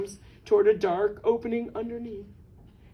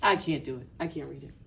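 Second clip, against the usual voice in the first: low, fading refusal; playful sorrowful protest; mock despair; 8 semitones lower, 5 dB quieter, faster than usual. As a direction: sink low and trail softer, as if giving up. Reader too upset to go on.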